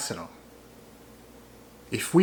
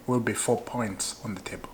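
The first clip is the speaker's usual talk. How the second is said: quietly repeated; 4 semitones lower, 7 dB quieter than usual. Shown as a demonstration. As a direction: drop the volume, let it sink in. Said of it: "Maybe you're not hearing me".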